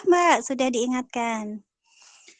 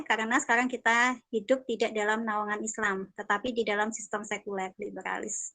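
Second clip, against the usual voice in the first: ordinary talk, nothing marked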